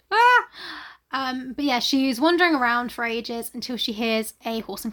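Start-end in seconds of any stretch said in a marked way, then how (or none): 0.1-0.5 short high loud 'ah'; startled yelp. Acted. Lost woman alarmed on the dark moors, startled yelp. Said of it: "she's a bit like".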